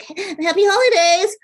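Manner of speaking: high, loud, sing-song mock cheer; mocking ex's greeting. Mocking the ex who keeps texting, high sing-song cheer. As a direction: parody the ex's fake-friendly hello. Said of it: "saying some stupid shit like".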